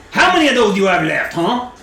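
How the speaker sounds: acted goading, pushing for an answer; taunting adventurer. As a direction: needle the cornered archer. Player taunts archer in character.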